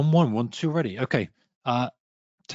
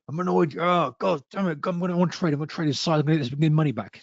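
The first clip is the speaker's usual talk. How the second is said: higher, half-acted irritation at the losses; mock annoyed outburst; 4 semitones higher than usual. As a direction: play the trader getting wound up, a bit over the top.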